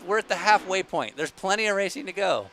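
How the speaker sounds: high, loud hammed-up race caller; play-by-play. For a chat that wants updates, mock play-by-play. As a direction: call the truck race like a mock commentator. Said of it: "let me give you the play-by-play".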